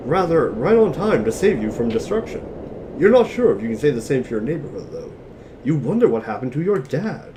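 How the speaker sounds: ordinary talk, nothing marked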